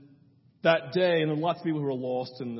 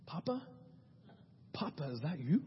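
put-on muffled child voice; 12 dB quieter than usual. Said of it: "you heard a muffled voice say".

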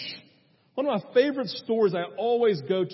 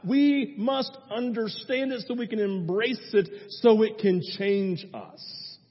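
ordinary talk

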